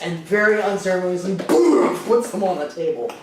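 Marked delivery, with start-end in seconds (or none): 1.4-2.0 loud, punched "boom"; imitated thud. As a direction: make the slam of fists with your voice. Imitates fists hitting the table.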